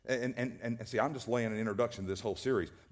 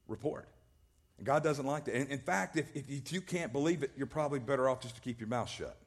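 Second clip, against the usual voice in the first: normal talking voice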